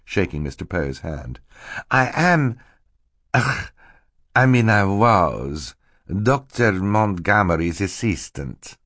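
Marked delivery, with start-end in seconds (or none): 1.9-8.8 false Stefano, faltering. Acted. Stefano feigns grief over his employer, faltering. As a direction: act too upset to finish.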